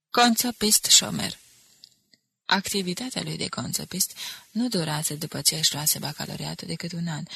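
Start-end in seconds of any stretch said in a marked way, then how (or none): 0.1-1.4 slow, louder, dryly ironic answer. A narrator's reading. Mardare giving his occupation at the regiment, with his usual irony. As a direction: spell it out with irony, pitched up. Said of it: "a răspuns cu obișnuită ironie".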